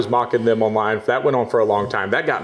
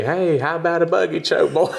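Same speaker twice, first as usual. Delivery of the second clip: higher, hammed-up Southern country accent; country accent taunt; 7 semitones higher than usual. Fans quoting his taunt back, hammed-up country accent. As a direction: quote the fans' favourite line in a country accent. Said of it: "They love my country accent".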